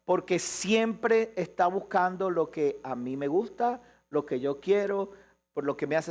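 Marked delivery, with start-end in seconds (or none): none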